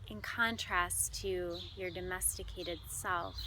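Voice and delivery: measured delivery with long, spacious pauses; guided meditation. Acted. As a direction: lead listeners gently through imagery, pausing between phrases. Leads animal imagery meditation.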